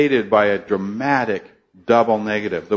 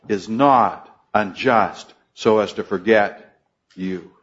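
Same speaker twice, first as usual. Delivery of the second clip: "not" leaned on, slow reading; slow stress on not; 3 semitones lower than usual. Shown as a demonstration. Preacher rereading the verse to the congregation, slow with stress on "not". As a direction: read "is not unjust so as to forget you" slowly, pressing "not". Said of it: "Look at the beginning".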